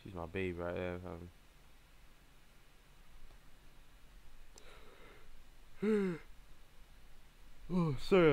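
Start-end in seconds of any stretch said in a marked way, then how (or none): 3.0-7.6 long breathy yawn. Real, not acted. Player yawning mid-game, tired. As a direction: yawn at length, mostly without voice. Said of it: "I was yawning".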